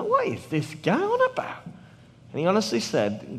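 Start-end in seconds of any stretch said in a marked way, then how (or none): 0.0-1.6 baffled disbelief. Retold or imitated.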